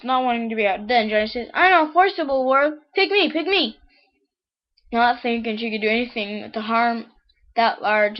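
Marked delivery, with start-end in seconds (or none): 1.5-3.8 high, excited pleading; eager pupil. Acted. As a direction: beg the teacher to pick you. Eager pupil begging to be picked.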